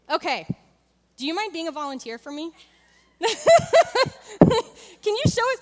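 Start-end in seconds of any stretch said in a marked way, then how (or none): 3.2-3.3 laughing question. Real, not acted. Laughing while asking the volunteer. 3.3-5.0 loud, high, real laugh; burst of laughter. Real, not acted. Volunteer stands up to juggle. 5.0-5.6 laughing question. Real, not acted. Laughing while asking the volunteer.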